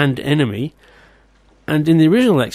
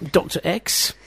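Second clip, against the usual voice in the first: ordinary talk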